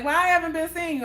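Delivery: high, quick imitated question; puzzled questioning. Boyfriend's mom asking why she stopped visiting, puzzled. His mother asks, not knowing of breakup.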